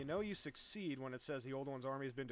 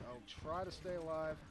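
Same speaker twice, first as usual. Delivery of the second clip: "try" strongly emphasized, rest kept raised; stress on TRY; 3 semitones higher, 4 dB louder than usual. Real, not acted. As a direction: lean hard on the word "try". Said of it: "I'm gonna emphasize the word try".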